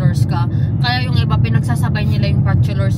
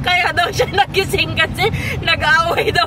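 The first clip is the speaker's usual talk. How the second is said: high, helpless giggles through the words; laughing while talking; 7 semitones higher, 4 dB louder than usual. Real, not acted. Retelling Daddy's dream of quarrelling over a truck, laughing. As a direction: tell it through fits of laughter.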